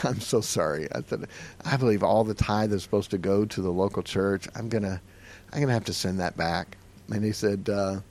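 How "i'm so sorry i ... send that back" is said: low, soft, regretful; subdued and sorry; reluctant apology. Retold or imitated. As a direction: break bad news gently. Reluctantly refusing friend's gift.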